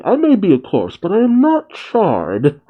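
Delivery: lightly voiced, huffy gentleman correcting them; indignant skeleton. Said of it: "And Brook's response is".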